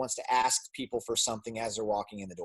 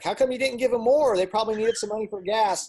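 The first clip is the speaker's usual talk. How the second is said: high, louder incredulous wife voice; mimicking wife; 9 semitones higher, 5 dB louder than usual. Wife scolding her husband for not giving more, in a high, incredulous voice. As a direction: play the wife asking why he didn't give more. Wife's reproach retold in her voice.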